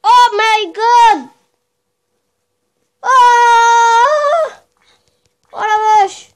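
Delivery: high, loud, startled cries; startled shout. Real, not acted. Player startled by a fast mob, yelling. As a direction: yelp in real shock as the mob charges.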